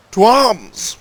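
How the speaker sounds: loud, high call to battle; a stirring battle cry ending a rebel notice; rallying shout. Acted. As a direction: rouse the crowd to fight.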